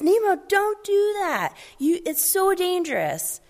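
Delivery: high-pitched fretting father voice; worried dad. Retold or imitated. Pastor voicing Marlin scolding Nemo, high anxious father. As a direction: squeak it out like an anxious fish dad. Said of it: "And Marlin says".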